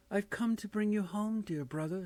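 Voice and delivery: put-on high, light child voice, gleeful; a small girl coming to fetch her brother; little girl's voice. Little Fan telling her brother she has come for him, high and gleeful. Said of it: "said the child, clapping her tiny hands".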